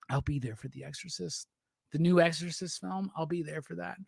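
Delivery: hushed spooky promise to Verno; mock ominous murmur. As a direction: drop the voice down low like a horror-movie threat. Teasing menace at Verno.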